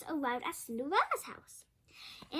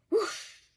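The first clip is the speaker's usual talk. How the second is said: magic whoosh; 6 semitones higher, 5 dB louder than usual. Acted. Magic wand sound.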